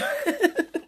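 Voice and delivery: short, high burst of laughter; high giggle. Real, not acted. Laughing at own joke, high short giggle. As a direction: giggle helplessly. Laughing after begging pardon.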